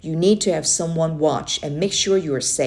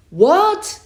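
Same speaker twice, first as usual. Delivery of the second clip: high, loud startled yell; 12 semitones higher, 7 dB louder than usual. Acted. Boy yells back at his uncle across the river, yelled. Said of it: "I yelled".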